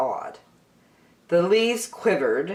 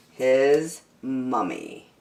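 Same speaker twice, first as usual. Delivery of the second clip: ordinary delivery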